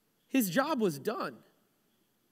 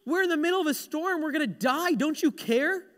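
high, urgent storm panic; acted frantic alarm, pitched up high; panicked mock yelling; 7 semitones higher than usual. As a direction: cry out as if about to drown. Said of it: "The disciples are yelling".

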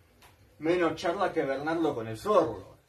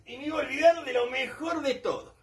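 voice breaking; 11 semitones higher than usual. Real, not acted. Gets emotional remembering.

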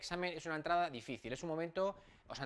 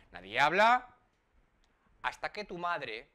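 ordinary talk, nothing marked

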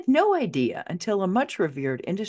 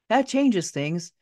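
ordinary delivery, nothing marked

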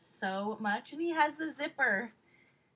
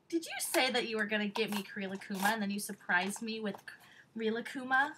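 normal talking voice

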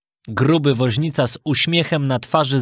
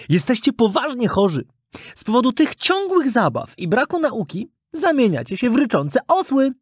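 high-pitched marmot character; diagnosing marmot; 9 semitones higher than usual. Acted. Marmot warning the boys they're becoming donkeys, grave. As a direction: pronounce the diagnosis in a raised character voice.

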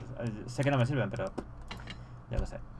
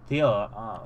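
normal talking voice

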